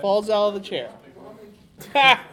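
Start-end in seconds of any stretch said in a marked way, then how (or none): 1.9-2.2 loud, high gloating "Ha!"; triumphant shout. Acted. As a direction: crow over the fallen neighbor. Pushing Leroy, triumphant shout.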